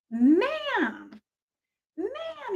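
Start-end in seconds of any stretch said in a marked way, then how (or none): 1.9-2.5 whispered, much higher and softer; a stage whisper of mock disapproval. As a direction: hiss the word ma'am as a whisper. Repeats it in a whisper.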